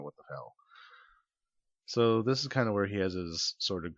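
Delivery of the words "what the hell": mumbled confusion. Retold or imitated. Left alone, baffled.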